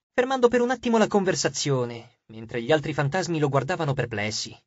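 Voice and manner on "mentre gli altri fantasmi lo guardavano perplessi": ordinary delivery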